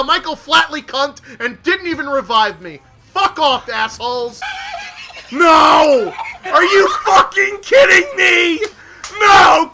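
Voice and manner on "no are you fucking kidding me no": loud outburst of disbelief; raging yell. Real, not acted. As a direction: shout it in real frustration. Raging at being killed.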